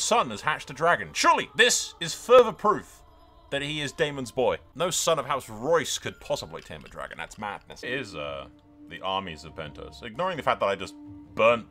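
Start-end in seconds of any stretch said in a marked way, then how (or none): none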